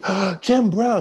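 high, gasping star-struck delight; squealed mock excitement; excited young woman. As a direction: imitate a girl thrilled at her visitor. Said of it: "She gets all excited".

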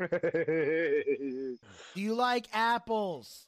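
slow low movie-line delivery; drawn-out movie-quote voice; Good Will Hunting quote. Retold or imitated. Quoting Good Will Hunting to his co-host, slow and low. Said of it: "ask Nathan if he likes apples".

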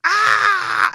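loud high scream of pain; hurting scream. Shown as a demonstration. Man showing how it must hurt, pained scream. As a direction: scream so that it hurts. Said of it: "it has to, like, hurt".

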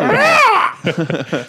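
high screeching bird cry; pterodactyl shriek. Acted. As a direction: screech like a flying dinosaur. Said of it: "First pterodactyl impression".

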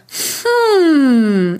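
demo hum. Shown as a demonstration. Coach showing a podcaster a morning hum. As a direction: hum it out gently. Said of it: "I would just hum by exhaling through your nose".

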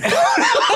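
high burst of real laughter; loud laugh. Real, not acted. Laughing at his own gaffe.

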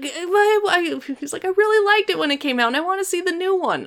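high-pitched teasing imitation; high mocking voice. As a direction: raise the pitch way up to mock him.

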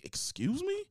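very high incredulous question; mock disbelief. Guest asked by housekeeping if he's leaving, mock disbelief. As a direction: squeak it out, taken aback. Retells shock at checkout news.

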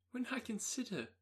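high, strained agitation; agitated. Acted. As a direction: voice climbing with panic. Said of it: "in yet a more agitated voice".

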